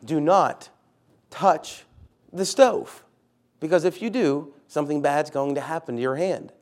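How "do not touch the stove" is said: "Do not... touch... the stove" in separate chunks, ending high and loud; emphatic "Do not / touch / the stove", pausing between chunks; full emphasis. Shown as a demonstration. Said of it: "how this all adds emphasis".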